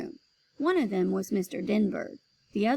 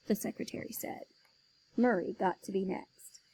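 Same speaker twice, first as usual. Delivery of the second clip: ordinary delivery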